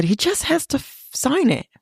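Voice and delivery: baffled she won't sign; confused. Retold or imitated. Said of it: "first confusion".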